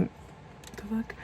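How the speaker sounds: breathy stunned whisper, slowed right down; whispered swear. Retold or imitated. Looking at a strange caller ID, a slow shocked whisper. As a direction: let it slip out under your breath, slowly.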